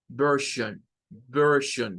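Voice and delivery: version as vershon with schwas; version, ending in a sh sound; English pronunciation. Shown as a demonstration. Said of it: "suena siempre a una schwa".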